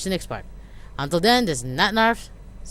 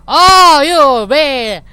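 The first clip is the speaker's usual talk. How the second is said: relieved outburst; 9 semitones higher, 15 dB louder, much slower than usual. Acted.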